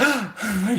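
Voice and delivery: sharp high frightened gasp; mock scared intake of breath; startled gasp. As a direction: gasp as if someone crept up behind. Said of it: "you're gonna be like".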